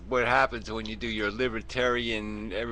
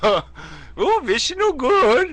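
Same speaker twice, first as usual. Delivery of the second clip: high, slow hammed-up Indian accent; exaggerated shopkeeper Indian accent; 12 dB louder, much slower than usual. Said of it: "do, like, an Indian accent".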